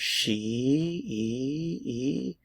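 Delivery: she, then e, e as a vowel drill; isolated ee vowel. Shown as a demonstration. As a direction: drill the long ee. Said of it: "notice the vowel sound".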